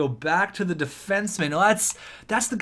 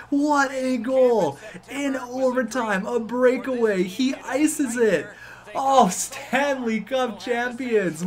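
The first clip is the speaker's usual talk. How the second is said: higher, slower, still laughing with joy; laughing elation; 7 semitones higher, slower than usual. Real, not acted. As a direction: savour the win, breathless. Celebrating Cup win.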